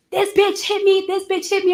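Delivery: mock freak-out. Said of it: "she started wilding out".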